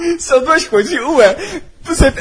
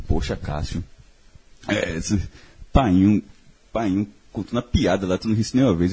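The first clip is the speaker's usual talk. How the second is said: much lower, softer mock voice of a relative; imitating a relative; 12 semitones lower, 6 dB quieter than usual. A relative quietly scolding Cássio for never laughing, low mock voice. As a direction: drop low and soft as the complaining relative. Imitating family member's complaint.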